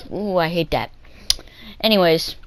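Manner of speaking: ordinary talk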